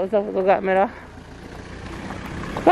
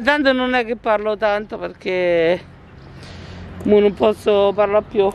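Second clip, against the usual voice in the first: ordinary talk, nothing marked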